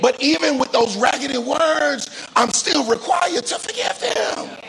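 high, ringing preacher cadence; impassioned preaching. Real, not acted. Preaches with raised pitch.

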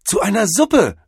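high, loud rapture; ecstatic cry. A narrator's reading. Cordell discovers life is soup, ecstatic cry. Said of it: "rief Cordell ekstatisch".

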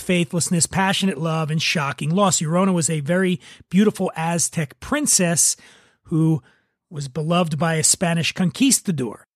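usual speaking voice

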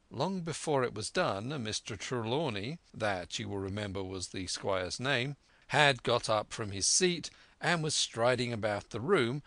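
ordinary delivery

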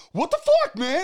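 very high, loud fake outburst; mock road-rage yell. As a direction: explode like an angry driver, hammed up. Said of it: "I didn't get out and go".

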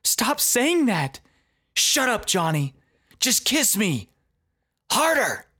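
high, urgent woman's voice; impassioned high yell; Ashley yelling. Retold or imitated. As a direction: demand it in a high pitch. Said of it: "Ashley began yelling too".

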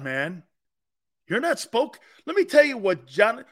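ordinary talk

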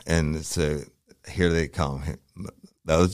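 normal talking voice

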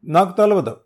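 high, loud denial; desperate denial. Acted. Choked thug denying, loud desperate denial. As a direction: insist in panic.

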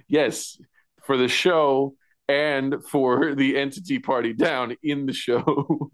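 ordinary delivery

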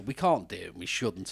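ordinary talk

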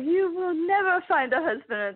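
high, theatrical condemnation; scolding matchmaker. Retold or imitated. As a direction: pronounce it like a furious matchmaker's verdict. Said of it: "when the matchmaker is like".

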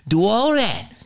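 very high-pitched, insistent tirade; worked-up rant. Real, not acted. Comedian challenges those pushing the shot, high-pitched rant. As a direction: push each demand out at the top of your range.

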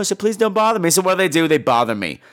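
normal talking voice

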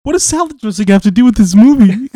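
put-on high, loud little kid asking mom; wide-eyed kid question; curious child voice. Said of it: "child asking mom".

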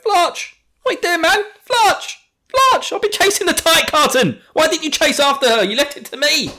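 high, loud frantic yell; Armin shouting. Retold or imitated. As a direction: scream it at Flotch, panicked and furious. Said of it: "And he's just like".

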